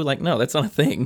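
ordinary talk

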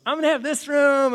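high, loud excited kid imitation. Kids picking out their rooms, excited. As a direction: call it out like a kid claiming a room.

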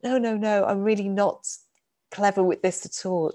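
usual speaking voice

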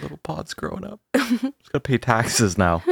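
wheezy, laughing voice after illness. Real, not acted. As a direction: breathe wheezily through the words. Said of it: "You're still a little wheezy?".

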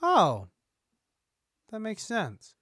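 ordinary delivery

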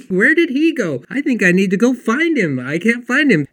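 high, loud, bubbly; voicing her cheerful bewilderment; excited retelling. Claudia telling Troy she was searching too, high and excited. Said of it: "And she laughed and she goes".

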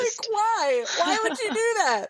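high-pitched, exasperated disbelief; incredulous. Acted. Podcast host reacting to food dyes in salmon, incredulous. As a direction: squeal the questions in comic dismay. Mock outrage at dyed salmon.

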